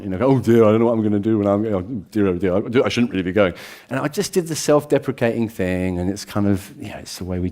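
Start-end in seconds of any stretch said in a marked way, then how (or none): 0.2-3.5 fast, flustered British dithering; posh-modest flustered ramble; Hugh Grant fluster. Retold or imitated. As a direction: dither like a bashful Englishman. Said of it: "We're all very kind of Hugh Grant".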